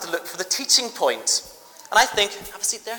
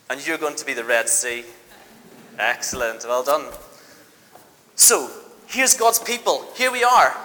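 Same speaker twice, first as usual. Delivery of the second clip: ordinary talk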